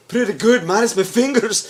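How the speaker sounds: high, loud mock-Scottish Davos; jokey high-pitched Scottish accent. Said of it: "I keep lapsing into Scottish accents".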